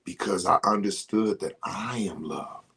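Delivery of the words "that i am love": whispered. Real, not acted. Soft declaration.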